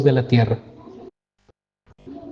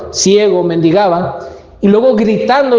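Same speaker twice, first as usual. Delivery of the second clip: normal talking voice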